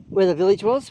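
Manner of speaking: ordinary delivery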